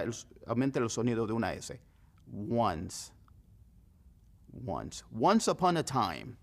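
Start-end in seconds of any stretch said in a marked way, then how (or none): 2.5-5.0 "Once" said like "one" plus a hissed s; "Once" as wans, s stretched out. Shown as a demonstration. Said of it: "imagínese one con una S". 5.2-6.4 slow fairy-tale "Once upon a time"; storyteller's opening line; storybook opening. Shown as a demonstration. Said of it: "así empiezan las historias".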